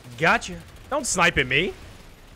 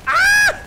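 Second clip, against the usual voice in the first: very high, loud startled scream; sudden shriek; 10 dB louder than usual. Real, not acted. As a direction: shriek as you get hit. Sudden death in game.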